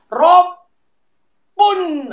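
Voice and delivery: Rob pun popped high, the p clearly closed; model pronunciation. Shown as a demonstration. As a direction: lips shut hard on the p of pun. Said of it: "dia jadi P".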